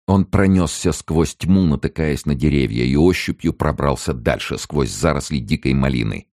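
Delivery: ordinary delivery, nothing marked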